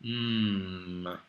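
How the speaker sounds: drawn "mm" of something tasty; yummy mm. Shown as a demonstration. Teacher hums "mm" over delicious cake. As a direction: hum "mm" like tasting cake. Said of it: "we use the letter mim to make this sound".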